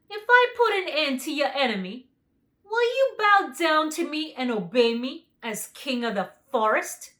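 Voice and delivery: the lion bargaining; lion's voice. A narrator's reading. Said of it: "inquired the lion".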